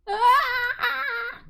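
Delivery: very high, piercing shout of glee; high excited shriek. Acted. Friend greeting a returning old pal, high glad shriek. Character screams seeing May.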